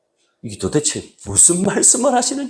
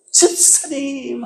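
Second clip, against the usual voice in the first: eager call; 8 semitones higher, 3 dB quieter, much slower than usual. Retold or imitated. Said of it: "막 안기고 싶은데".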